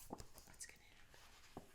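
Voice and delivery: suspenseful whisper. Acted. Storyteller teasing suspense, whispered. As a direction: whisper the question. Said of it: "aside to children".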